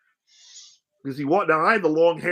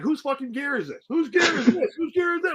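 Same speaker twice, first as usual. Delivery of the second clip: mock outraged yelling; 8 semitones higher than usual. Locker-room wrestler demanding whose gear this is, higher and angry. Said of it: "the other guy's like".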